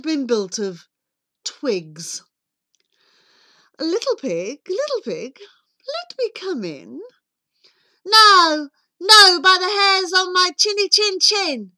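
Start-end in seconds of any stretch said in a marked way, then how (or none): 3.8-7.0 higher, lilting wolf wheedle; sing-song big bad wolf calling; coaxing wolf. Wolf coaxes pig to open up. 8.1-11.7 high, loud piggy refusal; indignant pig, shouting through the door; defiant little pig. Acted.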